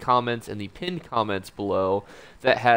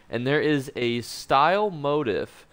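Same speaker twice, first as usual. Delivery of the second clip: ordinary talk, nothing marked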